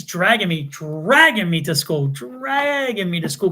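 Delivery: "dragging" stretched long and slow; drawn-out emphasis. Retold or imitated. Telling how mom hauled him to school, drawn-out "dragging". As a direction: draw out the first syllable of "dragging".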